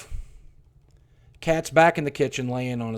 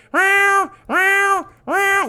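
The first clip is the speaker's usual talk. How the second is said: high, thin kitten meows, much higher and louder; cat meowing; 5 dB louder, much slower than usual. Retold or imitated. Narrator imitating the buried cat's meows, high and loud. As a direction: mew like a weak dying kitten. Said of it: "I hear".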